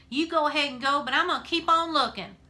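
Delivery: 높고 크게 외치는 반항; 딸이 아빠에게 혼자라도 찾겠다고 외치며; defiant shout. A narrator's reading. Opal defying father.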